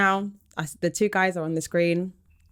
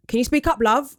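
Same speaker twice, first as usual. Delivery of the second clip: a higher, louder mock of a patronising bloke; condescending posh bloke; 6 semitones higher, 3 dB louder than usual. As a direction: talk down to her, higher. Quoting a guy's condescending reply.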